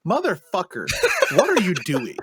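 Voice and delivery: mock exasperation. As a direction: retell cursing out your co-host in exasperation. Acted exasperated reply to co-host.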